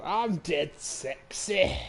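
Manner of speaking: slow, lightly voiced Fat Bastard; a mild drawn-out imitation of the film's Fat Bastard; Fat Bastard impression. Quoting Austin Powers, a hint of Fat Bastard. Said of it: "fat bastard in, uh, in Austin Powers".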